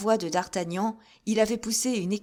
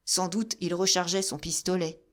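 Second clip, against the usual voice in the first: plain reading voice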